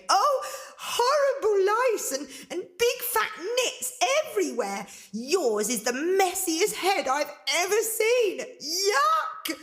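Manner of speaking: high, squealing disgust; disgusted cry. Acted. Girl disgusted by the ogress's hair, high cry. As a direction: screech out the yuck. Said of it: "Angelina cried".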